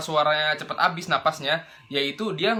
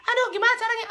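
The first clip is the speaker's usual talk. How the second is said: much higher, fretful complaint; high frustrated voice; faster than usual. Retold or imitated. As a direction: whine it like you cannot reach the note. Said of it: "pas dia mau niruin Michelle Rossi".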